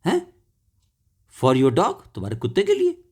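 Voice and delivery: open-mouthed "huh?", then rising disbelief; amazed, high-ended questioning; surprised question. A narrator's reading. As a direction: ask it in astonishment, pitch lifting high. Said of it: "आश्चर्य से उनका मुंह खुला खुला".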